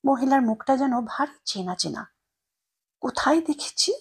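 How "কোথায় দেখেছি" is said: whispered to herself. Acted. Narrator whispers, trying to place the old woman's face. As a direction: wonder under your breath who she is.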